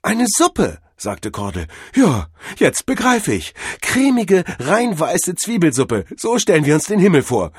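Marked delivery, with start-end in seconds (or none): 0.0-0.8 high, loud wonder; awed excitement. A narrator's reading. Cordell repeats his soup discovery, high wonder. 2.0-7.5 bask in the heavenly vision; rapture. A narrator's reading. Cordell pictures onion-soup heaven, rapturous.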